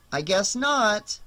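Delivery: a touch of disappointment, drawn out; disappointed. A narrator's reading. Annie gets no answer from the dark, mildly disappointed. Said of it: "she said, disappointed".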